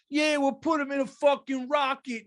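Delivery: high, loud, goofy friend voice; mock dumb friend answer. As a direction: play the clueless buddy blurting an answer. Voicing friend Tony's reply.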